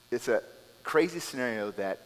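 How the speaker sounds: ordinary talk, nothing marked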